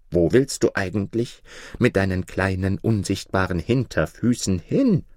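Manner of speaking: deep lion voice teasing; lightly voiced scornful lion; low mocking lion. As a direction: poke fun at his little legs. Lion mocks the carpenter.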